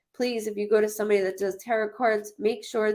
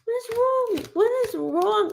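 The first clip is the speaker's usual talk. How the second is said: much higher, incredulous what is wrong; exasperated high cry; 13 semitones higher than usual. Real, not acted. Fed-up psychic ranting to viewers, incredulous. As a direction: plead to the heavens in disbelief.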